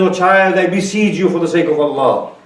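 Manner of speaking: high, loud pleading grandmother; impassioned pleading. Acted. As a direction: beg him not to go, full preaching force. Grandmother beseeching, preached.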